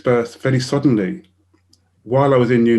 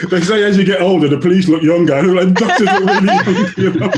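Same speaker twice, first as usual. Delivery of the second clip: talking through laughter, louder; laughing; 6 semitones higher, 6 dB louder than usual. Real, not acted. Telling the audience about young doctors, laughing. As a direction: laugh the punchline out.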